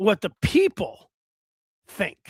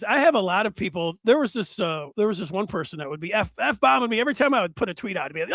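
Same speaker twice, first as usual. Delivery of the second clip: ordinary talk, nothing marked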